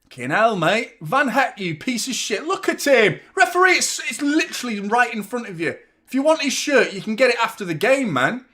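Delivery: loud, high furious rant at the referee; angry outburst. Real, not acted. Watching the match, raging at the defender and referee. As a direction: yell at the ref in real anger.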